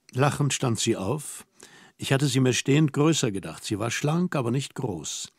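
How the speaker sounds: plain reading voice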